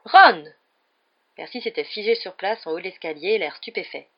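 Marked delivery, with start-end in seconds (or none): 0.0-0.4 loud, higher startling shout; booming call. A narrator's reading. Percy catches Ron on the stairs, shouting loudly. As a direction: call the name out sharply. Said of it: "une voix sonore les fit sursauter".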